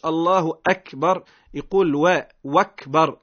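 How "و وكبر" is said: "وكبر" said slowly with a w sound in place of the hamza; wrong wakbar. Shown as a demonstration. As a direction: stress the mistaken w in "وكبر". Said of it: "هذا كذلك لا يصح".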